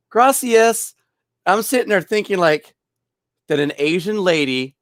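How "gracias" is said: high, loud gracias; customer's gracias. Retold or imitated. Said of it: "She goes".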